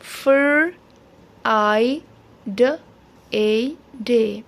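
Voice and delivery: Fir as one blend "frr", then long I, d, a, day; sounding out Friday. Shown as a demonstration. Teacher shows the blend in Friday, sounded out in pieces. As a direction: say Fir, I, d, a, day separately with pauses. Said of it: "यहाँ पर फिर एक blend है".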